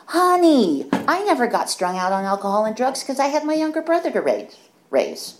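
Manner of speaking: put-on high, loud, brisk old lady voice; 1 old woman's matter-of-fact reply. Old Ramona answering the confession, high and blunt. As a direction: fire it back without missing a beat. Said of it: "she goes".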